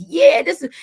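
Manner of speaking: loud, high and hyped, imitating herself; a burst of mock hype at her own music; imitating herself getting hyped. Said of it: "I get so crunk".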